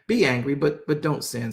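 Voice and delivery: usual speaking voice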